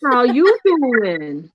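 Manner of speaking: exaggerated, stretched catchphrase delivery; Friends catchphrase. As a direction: drag out a Friends-style pickup line. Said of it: "It's Shelby from Friends".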